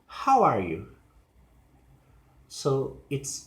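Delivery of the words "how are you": "How" stressed, dropping to "you"; strong "How" then a quick fall; falling question. Shown as a demonstration. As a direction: information question ending low on "you". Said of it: "Information question".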